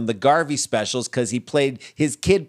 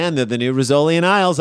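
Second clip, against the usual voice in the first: ordinary delivery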